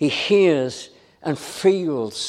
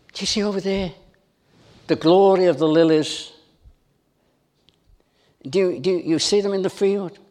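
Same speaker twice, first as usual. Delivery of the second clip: voicing Jesus; 3 semitones higher than usual. Preacher voicing Jesus asking the crowd, earnest plea. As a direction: urge them gently to see. Jesus voiced, pleading with listeners.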